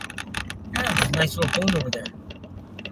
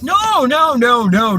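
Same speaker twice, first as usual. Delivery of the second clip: villagers' denial; 6 semitones higher, 7 dB louder, slower than usual. Retold or imitated. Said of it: "they're like".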